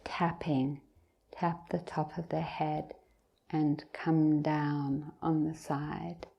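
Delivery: unhurried meditation guidance with long pauses; guided meditation. Real, not acted. Teacher leading meditators from the top of the head down, guided meditation. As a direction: guide them gently, leaving long silences between phrases.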